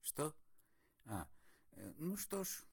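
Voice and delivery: put-on soft, deflated old man voice; disappointed old man. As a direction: let him sag, disappointed. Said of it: "несколько разочарованно".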